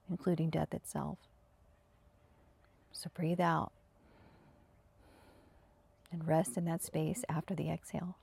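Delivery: calm, even meditation guidance with long pauses; spacious, unhurried meditation voice; guided meditation. Acted. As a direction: guide them into stillness, leaving space after each phrase. Leads breathing and senses meditation.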